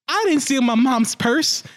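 mock-offended high voice; indignant Timmy quote. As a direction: protest innocence like a cartoon kid. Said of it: "He's like".

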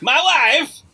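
hammed-up Borat cry of recognition; Borat catchphrase. George approaches Mary on the sidewalk, Borat impression. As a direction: call it out in a mock Borat accent.

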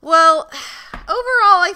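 booming high wrap-up; loud announcer. Real, not acted. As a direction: announce the verdict loudly.

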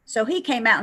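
ordinary delivery

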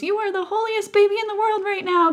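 high-pitched, adoring exclamation; imitated gushing praise. Priest praises the baby holding the cross, imitated gushing.

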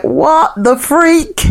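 high, slow shocked squeak; shocked exclamation. Retold or imitated. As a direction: recoil in disbelief. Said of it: "And I was like".

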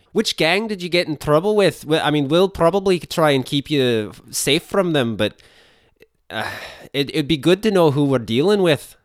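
hammed-up Russian accent. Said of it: "This is becoming Russian".

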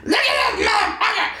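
shrill hammed-up rage, yelling at traffic; imitating road rage. Husband imitating wife raging in rainy traffic, high mock shout. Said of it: "she will turn into a Steven in a second".

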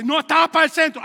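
loud, high comic retort; mock indignant answer. Church member answering about the eye, loud mock indignation. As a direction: shout the absurd answer as if outraged.

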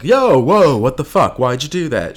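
loud, high burst of alarm; shocked doctor. Retold or imitated.

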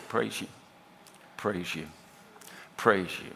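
very low, soft murmur with long pauses; hushed prayer. Retold or imitated. Pastor shows his quiet morning praise to God, hushed prayer.

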